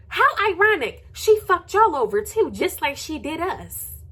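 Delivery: high taunting sarcasm; sarcastic. Real, not acted. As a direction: gloat over her downfall. Sarcastic jab continues.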